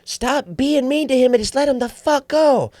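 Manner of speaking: high mock woman's yell; shrill-free high imitation of a lady telling him off; imitating a lady yelling at him; lady yelling back. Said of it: "I hear some lady yell back".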